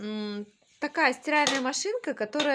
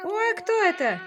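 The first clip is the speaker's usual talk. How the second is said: loud, high oh; mock surprise; 7 semitones higher, 6 dB louder than usual. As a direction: act startled at a toy. Mom feigns surprise.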